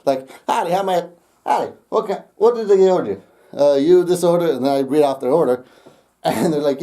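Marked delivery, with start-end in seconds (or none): 0.5-4.4 higher, put-on country southern accent. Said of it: "southern accent".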